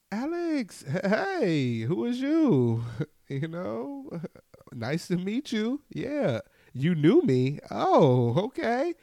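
high, loud excited hello; mock meeting relatives, high and loud; eager greeter. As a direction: play the overfriendly relative. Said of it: "relatives greeting, speaker replies".

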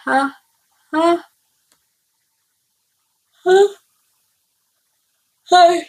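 rising sharp ha breaths before a sneeze; building sneeze. Real, not acted. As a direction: gasp up toward a sneeze. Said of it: "noch mal niesen". (Niesen, das sich aufbaut)

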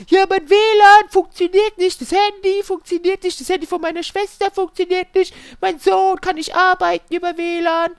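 high, loud complaining customer voice; upset customer. Retold or imitated. Customer listing broken devices, high outraged mock voice. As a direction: rattle off the complaints in a shrill mock whine. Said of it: "mega aufgebracht".

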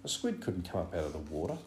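usual speaking voice

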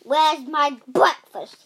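loud, booming demand; roaring giant. Acted. Giant roaring at his wife for food. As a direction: roar for breakfast. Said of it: "he roared".

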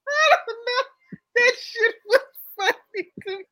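high, loud laughing, close to crying; helpless tearful laughter; can barely get it out for laughing; laughing to tears. Real, not acted. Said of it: "You are literally over there weeping".

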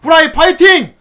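high, loud rallying yell, last word stretched; shouted cheer. Acted. Host reading a fan's cheers for the show, shouted cheer. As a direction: belt it out like a cheer. Reads out the message's closing cheers.

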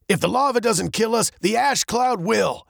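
quick high-pitched panic; panicked. A narrator's reading. Captain trapped by lava and ash, fast and panicked.

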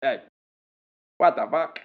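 put-on high kid's voice with a Thai accent; little Thai accent. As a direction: imitate a cute first grader's Thai-accented English. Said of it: "In a little Thai accent".

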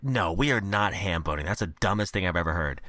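low, dismissive refusal; Mordecai refusing. Retold or imitated. Mordecai rejecting ham boning, low and dismissive. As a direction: drop the voice and shut it down. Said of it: "Mordecai's like".

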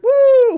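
high, loud "woo!"; long, high mock party holler; party whoop. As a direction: whoop like a reveller in a crowd. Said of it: "all these guys are like".

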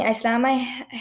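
ordinary delivery, nothing marked

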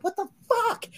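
very high shocked squeal; disbelieving shriek. Retold or imitated. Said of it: "I'm like".

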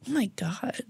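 a shocked, breathy "Oh my God"; offended whisper. Retold or imitated. Pretending to be offended in a fake taxi fight, whispered. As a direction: whisper it, truly offended for your character. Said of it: "the way your voice just changed".

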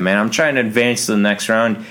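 ordinary talk, nothing marked

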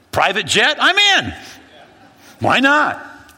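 very high, loud eager exclamations; excited yes. Retold or imitated. Accepting a pastor's invitation to fly on a private jet, excited. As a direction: jump at the offer.

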